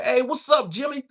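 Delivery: high-pitched hillbilly call, lightly mocked.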